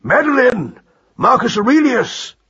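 high, carrying summons; calling out. A narrator's reading. Uncle summoning the others from their compartment, calling out high. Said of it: "called Roland".

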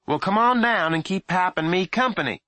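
high loud mother voice; mother calling. A narrator's reading. Mother calling her son down to join them, loud. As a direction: call him down like a nagging mom.

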